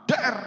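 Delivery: loud, high verbal bang; sudden spoken impact noise; imitated crash. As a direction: hit it like a sudden blow.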